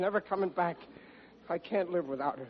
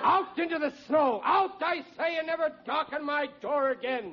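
hammed-up furious shout, much higher; melodramatic shout; 11 semitones higher than usual. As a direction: throw her out like a stage villain father. Melodramatic father ousts daughter.

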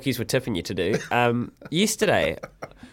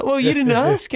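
mate's reply; 13 semitones higher, 4 dB louder than usual. Retold or imitated. Mate voiced in story.

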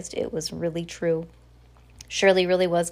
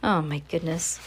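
usual speaking voice

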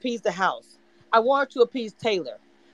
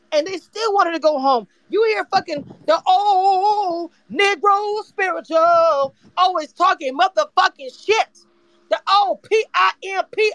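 raised pitch, words leaned on hard; heated rant; 7 semitones higher, 4 dB louder, slower than usual. Real, not acted. Ranting to the room about the housemate, heated. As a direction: rant it out, stretching the key words.

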